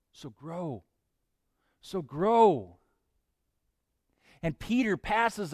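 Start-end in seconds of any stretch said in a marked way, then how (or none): 0.1-0.8 a hushed, inward "So grow"; soft repeat. Acted. Preacher repeats the text softly. 1.8-2.8 louder, pressing "So grow" with "grow" landing hard; firm call. Acted. Preacher repeats the text firmly.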